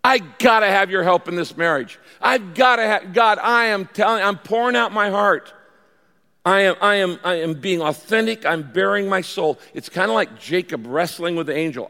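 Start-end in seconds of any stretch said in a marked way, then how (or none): 0.0-9.6 raised, insistent pleading with God; gut-wrenching prayer. Shown as a demonstration. Praying for help in business and marriage, gut-wrenching plea. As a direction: pour it out emphatically, pressing every phrase. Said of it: "This is gut-wrenching praying".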